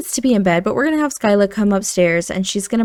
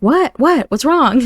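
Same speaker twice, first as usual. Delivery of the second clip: lightly acted alarm, pitched up; worried; 6 semitones higher, 3 dB louder, slower than usual. Man woken up by his partner, mildly panicked worry. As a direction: startle awake with quick worried questions. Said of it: "He's really worried".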